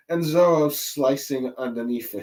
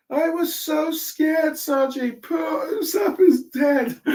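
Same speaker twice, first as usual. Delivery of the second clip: much higher, slow whimpering lament; scared Nami; slower than usual. Retold or imitated. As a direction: sob it out, frightened and self-pitying. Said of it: "As she goes like".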